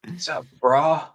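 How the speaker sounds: mock stoner greeting, half whispered; stoner impression. Joining in the joke about fake accents, whispery stoner. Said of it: "they always go right to the stoner".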